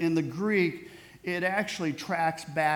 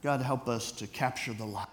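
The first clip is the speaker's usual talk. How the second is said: prayer; 3 semitones lower than usual. Acted. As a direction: pray it low and sincere for the congregation. Pastor leads closing prayer.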